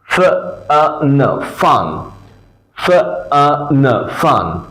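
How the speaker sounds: ফান split into ফ, আ, ন, then blended; sounding out. Shown as a demonstration. Teacher drills ফান for students to repeat, sounding it out. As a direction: separate sounds with gaps, then whole ফান. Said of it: "আমার সাথে সাথে কয়েকবার বলতে হবে".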